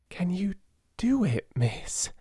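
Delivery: hesitant, voice rising high and unsure; faltering. A narrator's reading. Becky timidly asking Sarah, faltering and high. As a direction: falter out the question. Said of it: "faltered Becky".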